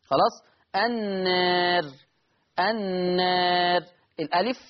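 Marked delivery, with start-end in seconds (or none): 0.8-3.8 "النار" held long, aa tilted toward eh; drawn-out "النار", vowel between aa and ee; taqlil. Shown as a demonstration. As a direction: recite "النار" slowly with a doubled n and an inclined vowel. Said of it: "المقلل قولا واحدا".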